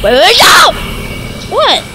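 high, rising panicked yell; alarmed shout. Real, not acted. Vlogger trying to stop a mishap, alarmed shout. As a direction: cry out to stop it.

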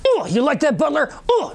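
high mocking taunt. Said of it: "and I go".

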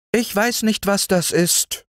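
very high, innocent mayfly voice. A narrator's reading. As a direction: puzzle sweetly as the mayfly. Mayfly puzzled.

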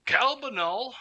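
high, sing-out call rising at the end; questioning call. Acted. Gnome calls for someone.

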